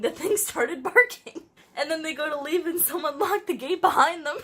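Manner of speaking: giggling while summarizing; laughing. Real, not acted. Cracking up retelling her childhood story, laughing.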